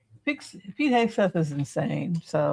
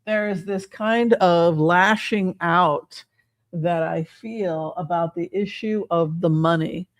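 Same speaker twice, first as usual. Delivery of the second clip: ordinary talk, nothing marked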